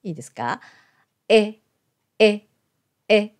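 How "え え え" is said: "え" halfway between Japanese i and e; short "え" shaded toward i; vowel between i and e. Shown as a demonstration. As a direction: say "え" with an e-shaped mouth aiming at i. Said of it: "日本語のいとえの中間の音".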